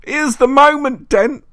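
very high, loud hate-filled shriek. A narrator's reading. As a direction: scream it in hatred. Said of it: "shrieked the voice".

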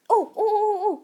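high, loud sudden realization; excited. Real, not acted. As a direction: blurt it out with a sudden idea. Sudden idea.

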